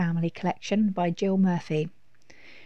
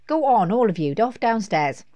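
loud, raised mum's order; firm dismissal; 3 semitones higher, 7 dB louder than usual. A narrator's reading. Mum sends the children downstairs, firm and loud. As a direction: shoo them out, louder and higher.

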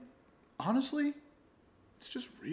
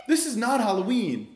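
usual speaking voice

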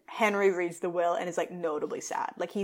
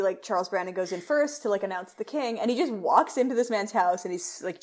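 usual speaking voice

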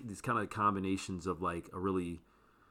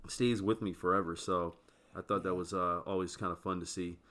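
ordinary talk

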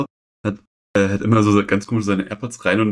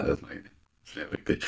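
whispered disgust; 6 semitones lower, 13 dB quieter than usual. Real, not acted.

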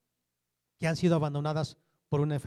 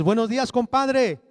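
"Buenos días, compadre" called out louder; loud greeting; 5 semitones higher, 10 dB louder than usual. Retold or imitated. Greeting the compadre sitting by the road, called out loud. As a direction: call the greeting across the road to your compadre. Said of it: "le hablaste fuerte".

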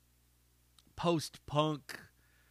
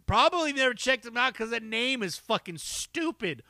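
ordinary talk, nothing marked

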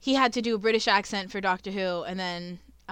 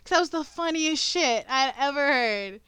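higher, louder, giggly telling; laughing excitedly; 6 semitones higher, 9 dB louder than usual. Real, not acted. Friend describing how hard she laughed, still giggly.

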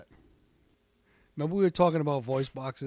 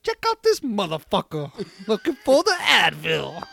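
Jackson impression; 5 semitones higher, 6 dB louder than usual. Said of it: "Samuel L. Jackson voice box".

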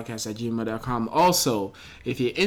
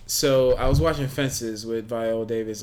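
ordinary talk, nothing marked

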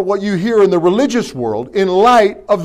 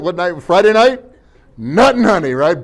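ordinary talk

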